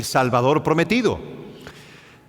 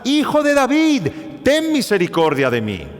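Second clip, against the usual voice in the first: crying out louder; 7 semitones higher than usual. Retold or imitated. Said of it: "él clamaba mucho más".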